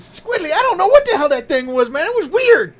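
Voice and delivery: put-on high, loud little kid voice; freaked-out child shouting to a pal; Nate. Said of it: "Nate says".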